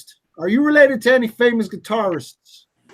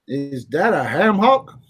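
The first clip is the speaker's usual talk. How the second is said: usual speaking voice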